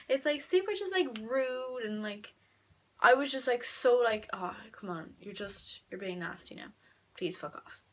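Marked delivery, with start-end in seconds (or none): 4.3-7.8 low, hushed grumble; quietly fed up, under the breath; exasperated muttering. Retold or imitated. Venting about a nasty classmate, exasperated mutter. Retells her exasperated inner reaction.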